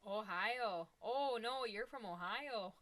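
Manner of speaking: hammed-up Ohio accent, loud, stretched vowels; mock Ohio accent. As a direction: tease with a drawn-out Ohio accent. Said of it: "I got my Ohio accent".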